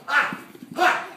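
short, loud "ah" and "ha"; explosive kick shouts; martial arts yells. Shown as a demonstration. Coach drilling the jump, loud explosive yells. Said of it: "you gotta yell, yell".

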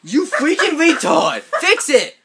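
very high, loud angry demand; real outraged squabbling shout; furious yell. Real, not acted. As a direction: shout orders in high-pitched fury. Yelling at the culprit over bed.

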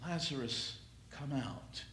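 gentle hushed summons; soft echo. Acted. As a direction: let the call fade to near a hush. Soft repeat of the call.

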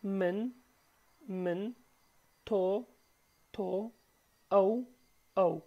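each word said twice, slow and clear, for learners to follow; careful teacher's repetition; slow repeat drill. Shown as a demonstration. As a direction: read the pronouns aloud like a drill. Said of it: "Let's read it one more time".

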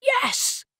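high cracking cry of pride. Acted. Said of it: "His voice cracked".

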